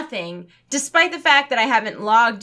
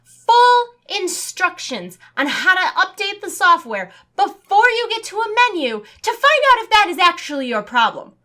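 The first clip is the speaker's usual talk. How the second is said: fed-up caller ranting about the endless menu, louder; exasperated rant; 7 semitones higher, 5 dB louder than usual. Real, not acted. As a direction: vent the outrage at the menu. Outraged at useless phone menu.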